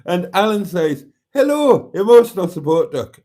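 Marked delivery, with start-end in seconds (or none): 1.3-3.2 loud, high attempt at Scottish that slips into Irish; botched Scottish accent. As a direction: greet them in a badly faked Scottish accent, coming out Irish. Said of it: "Oh, no, that's Irish".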